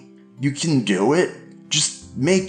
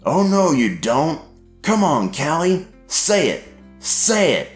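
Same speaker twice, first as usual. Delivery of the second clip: higher, forceful demands; shouting dad; 9 semitones higher, 3 dB louder than usual. A narrator's reading. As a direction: shout at her to say it. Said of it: "he shouted".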